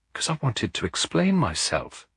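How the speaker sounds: on the edge of tears; voice breaking. A narrator's reading. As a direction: voice cracking with emotion. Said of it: "her voice breaking".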